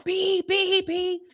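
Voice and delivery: high-pitched spoken honks; repeated high beeps, voiced like honking; car horn imitation. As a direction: mimic an insistent car horn. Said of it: "they honking their horn".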